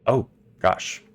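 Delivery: ordinary talk, nothing marked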